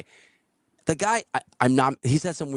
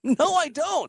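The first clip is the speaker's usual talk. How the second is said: high, squeaky refusal; 13 semitones higher, slower than usual. Retold or imitated. Refusing the drifter's hangout offer in a high, strained voice. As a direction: pitch way up, nervous brush-off.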